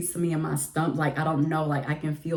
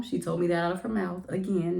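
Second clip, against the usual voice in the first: ordinary talk, nothing marked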